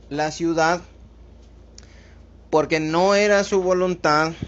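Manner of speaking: loud, rising sermon climb; fervent preaching. Acted. Pastor pressing the point on his flock, in loud fervent preaching. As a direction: preach it out to the congregation, full force. Preacher builds to full force.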